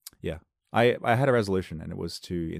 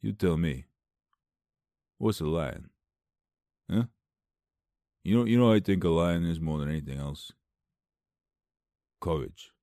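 low mock tough-guy musing; Sylvester Stallone impression; 3 semitones lower than usual. Actor musing on lions, low Stallone impression. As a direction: ponder it in a low put-on voice. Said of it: "why he sounds like Sylvester Stallone in this".

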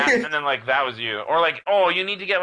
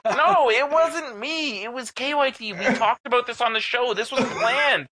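much higher, slow, laughing protest; mock indignant denial; 12 semitones higher than usual. Player blaming a friend for the fart, high mock protest. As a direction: squeal out an innocent denial.

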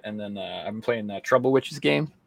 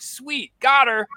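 much higher, louder, shouted triumph; mock triumph; 7 dB louder, much slower than usual. Gamer to friends about rescuing Ashley, mock triumphant shout. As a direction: cheer like you've won. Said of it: "I'm like".

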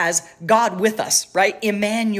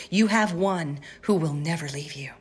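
ordinary delivery

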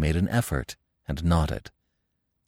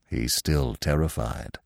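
lightly voiced old stag, low and slow; a hint of a wise old stag, low and soft; 7 semitones lower, 5 dB quieter than usual. A narrator's reading. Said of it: "said the old stag".

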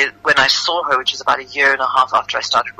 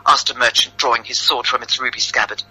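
usual speaking voice

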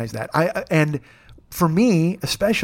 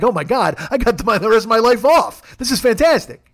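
mock delight; 7 semitones higher, 4 dB louder than usual. Voicing Karen's relief.

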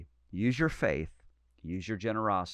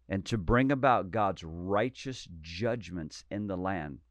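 ordinary delivery

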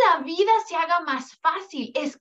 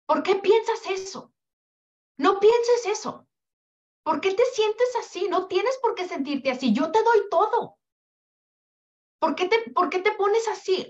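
put-on higher-pitched, scolding mom questioning her child; judging mother; 6 semitones higher than usual. Mom judging her child's feelings, higher scolding voice. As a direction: nag like a mom who can't understand her kid. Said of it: "Los juzgamos".

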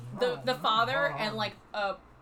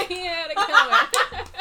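laughing; 9 semitones higher, 10 dB louder than usual. Pretend. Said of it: "play off, like, nonchalance".

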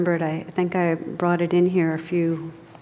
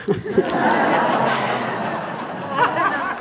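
airy laugh; 8 semitones higher than usual. Real, not acted. Laughs at a weird email.

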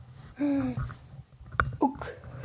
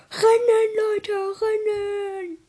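shouting; 7 semitones higher than usual. Acted. Late for school, urging friends.

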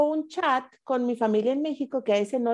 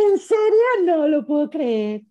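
amazed disbelief; 5 semitones higher, 3 dB louder than usual. Retold or imitated. Contestant retelling the news of getting into the program, amazed disbelief. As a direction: re-enact stunned delight, slowing down.

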